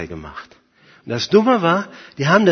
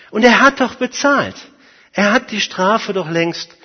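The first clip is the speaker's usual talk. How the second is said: raised, higher voice punching the words; emphatic insistence; 7 semitones higher, 5 dB louder than usual. Acted. Advocate insists the penalty is already paid, loud and emphatic. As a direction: argue the case forcefully, voice lifted. Preacher pleads the advocate's case.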